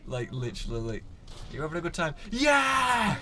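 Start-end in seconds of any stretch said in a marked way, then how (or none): 1.5-3.2 put-on loud high drunken shout; shouty drunk. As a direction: yell like a drunk partier. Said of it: "I was the shouty drunk".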